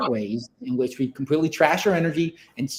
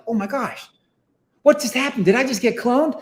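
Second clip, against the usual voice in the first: startled; 7 semitones higher than usual. Retold or imitated. Neil panics at being cloned.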